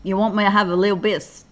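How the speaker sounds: playful attempt at an American twang; put-on twang. Shown as a demonstration. Host trying a twang for her online listeners, joking twang. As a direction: stretch the vowels into a joking twang. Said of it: "twang to my, to my speaking".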